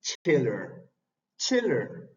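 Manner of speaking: chiller with a sharp ch and a short e; modelled chiller. Shown as a demonstration. Teacher modelling chiller after explaining the vowel. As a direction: clip the i of chiller toward che. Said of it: "La I sería che".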